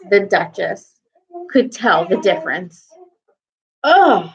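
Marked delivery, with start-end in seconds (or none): 3.8-4.3 a loud, high grunt of disgust; disgusted ugh. A narrator's reading. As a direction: spit out the bad taste. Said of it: "she said".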